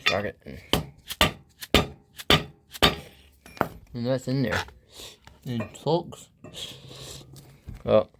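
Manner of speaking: talking around a flashlight held in teeth; muffled words past a flashlight; flashlight in mouth. Real, not acted. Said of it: "I'm gonna put a flashlight in my mouth".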